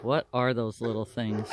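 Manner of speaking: low, soft, puzzled alpaca voice; alpaca voiced. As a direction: lightly voice the confused alpaca. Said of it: "He's like".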